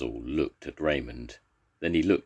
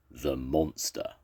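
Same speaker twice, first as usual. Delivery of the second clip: plain reading voice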